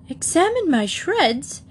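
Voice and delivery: slow, high-pitched indignant exclamation; outraged, drawn-out query; hot indignation. A narrator's reading. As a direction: draw it out, hotly affronted. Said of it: "he exclaimed hotly".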